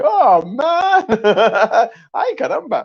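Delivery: high squeaky exclamation, laughing; imitating friend. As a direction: squeal it like a cartoon. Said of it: "he was like".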